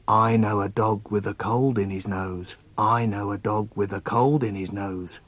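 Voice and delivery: low, soft chant; soft, low poetic refrain; hushed poem refrain. Acted. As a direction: intone it quietly on a level pitch.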